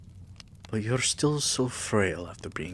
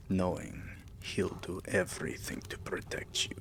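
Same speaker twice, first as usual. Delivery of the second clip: ordinary talk, nothing marked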